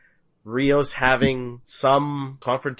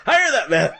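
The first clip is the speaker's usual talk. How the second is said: mock sitcom bluster, pitched higher and louder; George Costanza impression; 12 semitones higher, 8 dB louder than usual. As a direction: ham up the boss declaring the hire, lightly. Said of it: "it's like George Costanza".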